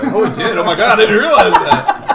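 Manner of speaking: loud, high, laughing surprise; surprised. Real, not acted. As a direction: realize it with delighted shock. Surprised he rhymed.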